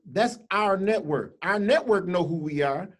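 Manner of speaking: ordinary delivery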